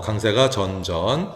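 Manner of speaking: ordinary delivery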